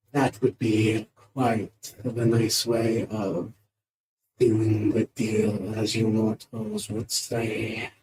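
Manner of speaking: slow, loud monster voice; stately beholder addressing mere mortals, very slow. Acted. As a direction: speak as an otherworldly creature, drawn-out and booming. Speaks in character as a beholder.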